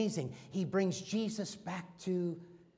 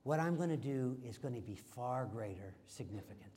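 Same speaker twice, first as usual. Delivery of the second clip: much lower and softer command; calm Jesus; 6 semitones lower, 8 dB quieter than usual. Retold or imitated. Jesus calmly stopping Peter, low and soft. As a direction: quietly halt him with authority. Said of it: "Jesus said".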